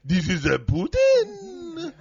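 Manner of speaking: very high, slow vampire voice; vampire Putin. Pretend. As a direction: drawl it out like a cartoon vampire. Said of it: "What is he, a vampire?".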